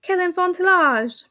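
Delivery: high, drawn-out French scolding; Hortense. A narrator's reading. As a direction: exclaim in French, reproving. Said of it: "began Hortense".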